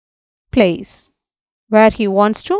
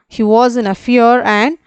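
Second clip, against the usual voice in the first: normal talking voice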